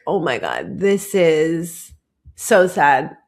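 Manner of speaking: low, slow, theatrical French melancholy; a hint of dramatic French sadness; mock French despair. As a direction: sigh it out like a tragic Parisian. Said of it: "I was being very French about it".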